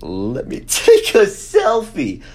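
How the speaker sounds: high, loud, slow, laughing through it. Retold or imitated. Vlogger reads his old caption, laughing. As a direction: read your old caption, cracking up. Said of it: "My comment is".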